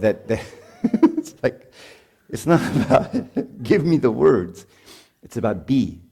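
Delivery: talking through real laughter; words broken up by laughs; laughing. Real, not acted. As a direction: crack up mid-sentence and push on. Cracks up at his own example.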